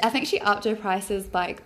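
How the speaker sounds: usual speaking voice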